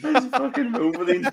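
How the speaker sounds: high, laughing disbelief. Real, not acted. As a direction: blurt it out through a laugh. Laughing at the film.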